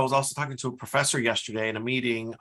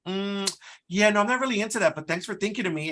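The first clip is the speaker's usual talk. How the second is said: higher-pitched, mock-polite brush-off; imitated breezy refusal; 7 semitones higher, faster than usual. Worker turning down the boss before walking away, imitated breezy refusal. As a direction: mimic someone casually declining, pitched up. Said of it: "the Gen X saying".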